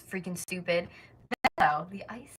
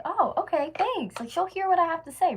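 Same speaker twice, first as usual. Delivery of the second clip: very high, louder, perky put-on thanks; mock grateful relief; 12 semitones higher, 7 dB louder than usual. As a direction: act out an eager, hopeful reply way up high. Acts own grateful reply to lady.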